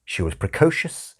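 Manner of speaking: ordinary delivery, nothing marked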